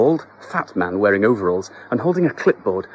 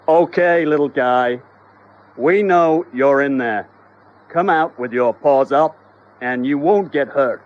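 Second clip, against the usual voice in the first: louder loudhailer announcement; megaphone call; 5 semitones higher, 8 dB louder than usual. Acted. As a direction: call out like a police negotiator.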